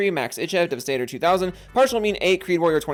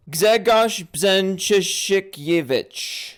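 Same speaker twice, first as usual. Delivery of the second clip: very slow, stretched syllables, higher voice; laboured sounding-out; 4 semitones higher, 4 dB louder, much slower than usual. Real, not acted. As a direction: work through the tricky name piece by piece. Struggles through a hard name.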